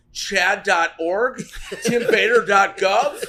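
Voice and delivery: rowdy shouted sign-off plugs, laughing; loud. Real, not acted. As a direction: yell the plugs like a rowdy closing bit.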